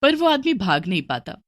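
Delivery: ordinary delivery, nothing marked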